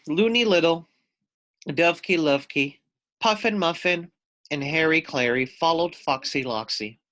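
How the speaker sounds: ordinary delivery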